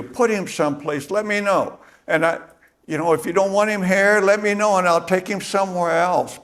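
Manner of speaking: frantic pleading. Retold or imitated. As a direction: beg him quickly, voice climbing high. Mary pleading frantically with gardener.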